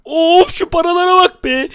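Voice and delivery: high, drawn-out wowed exclamation; amazed delight. Real, not acted. Gamer finds piles of money, high amazed exclamation.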